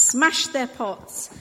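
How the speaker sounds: ordinary delivery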